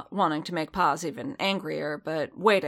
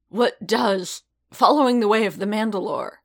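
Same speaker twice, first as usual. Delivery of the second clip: raised, louder, incredulous challenge; indignant; 4 semitones higher, 7 dB louder than usual. A narrator's reading. Paz challenges Din over the creed, indignant and raised. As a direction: throw the question back with heat.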